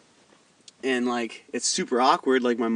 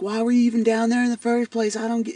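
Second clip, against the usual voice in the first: much higher, indignant stepdad voice; mimicking stepdad; 10 semitones higher than usual. Mocking the stepdad who resents the cops at his house, high whiny voice. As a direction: whine his question back at him. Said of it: "He was like".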